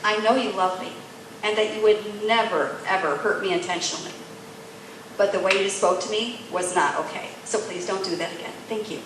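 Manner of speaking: low, gentle, measured firmness; calm, respectful. Retold or imitated. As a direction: set a boundary tenderly, softening toward the end. Retells her calm respectful boundary.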